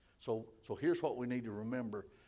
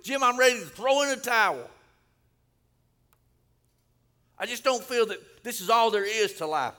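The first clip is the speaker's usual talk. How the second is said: high, loud shout of someone giving up; acted exasperated shout, pitched high; desperate outcry; 10 semitones higher, 13 dB louder than usual. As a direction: cry out a retold plea to the pastor. Acts out a congregant giving up.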